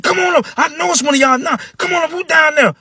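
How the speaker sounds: put-on high, loud angry old man shouting. Old man catching the boys, angry high shout. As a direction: yell down the stairs like a furious old man.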